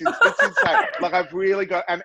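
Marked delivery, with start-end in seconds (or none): 0.0-0.8 laughing through the words. Real, not acted. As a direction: break into laughter as you say it. Laughing while describing drag outfits.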